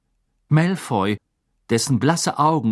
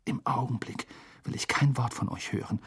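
hushed, much softer; whisper; 9 dB quieter than usual. A narrator's reading. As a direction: whisper it to your friends. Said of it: "flüsterte Ron".

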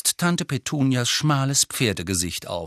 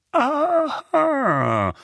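high, drawn-out triumphant aha; long triumphant exclamation, falling at the end; 4 dB louder than usual. A narrator's reading. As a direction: gloat as if catching him out. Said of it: "flüsterte Onkel Vernon triumphierend".

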